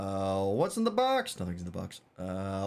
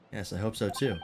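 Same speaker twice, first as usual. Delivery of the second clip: ordinary talk, nothing marked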